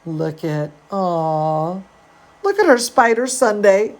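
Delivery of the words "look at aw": ordinary delivery, nothing marked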